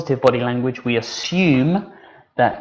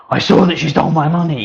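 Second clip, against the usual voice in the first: higher and louder, "saw" hit hard; emphatic outburst; 5 semitones higher, 8 dB louder than usual. Shown as a demonstration. Teacher contrasting a confident accusation, loud and emphatic. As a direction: declare it loudly with big gestures. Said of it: "I'm using my gestures".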